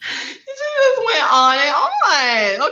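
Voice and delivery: slow, tearful real laughter; barely holding it together from laughing; laugh-crying. Real, not acted. Remembering the comedian's bit, slow laugh-crying. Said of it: "Okay, sorry".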